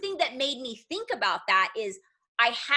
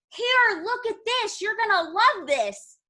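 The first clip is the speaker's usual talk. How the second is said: high, bright-eyed pushy pitch, much higher; eager sales pitch; 8 semitones higher than usual. Retold or imitated. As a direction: thrust it at him like a great deal. Pushing catalog on stranger eagerly.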